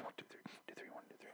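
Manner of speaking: whispered counting in the background; imitated looping broadcast. Imitating the radio repeating behind today's episode, whispered.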